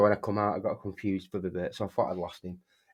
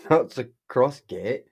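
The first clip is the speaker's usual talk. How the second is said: laughing; 4 semitones higher, 6 dB louder, slower than usual. Retold or imitated. Correcting friend while laughing.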